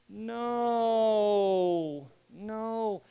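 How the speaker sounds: drawn-out despairing no; like the end of the world; toddler-style wailing no; dismayed no. Shown as a demonstration. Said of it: "musical inflection".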